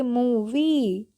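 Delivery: Movie stretched very slowly, the long ee held; teacher models movie slowly with a long ee; drawn-out demo. Shown as a demonstration. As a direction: spell out movie slowly for the class. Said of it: "तो हम इसको क्या पढ़ेंगे".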